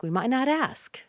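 natural-speed "might not ask", t as d; fast might not. Shown as a demonstration. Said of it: "Might not is very fast".

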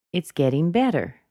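"better" lifted, then dropped suddenly; final falling swoop on "better"; rise then sudden fall. Shown as a demonstration. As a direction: stress "better" high and fall to finish. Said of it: "it rose even higher and then dropped down suddenly".

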